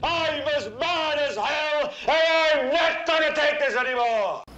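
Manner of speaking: very high, stretched-out wail of outrage; angry yell. Acted. Anchor leading viewers in the cry, outraged high yell. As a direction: draw it out as a climactic battle cry. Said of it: "stick your head out and yell".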